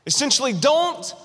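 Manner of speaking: Paul's plea, pushed high then dropping; preaching emphasis. Retold or imitated. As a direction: preach Paul's words with urgent rises.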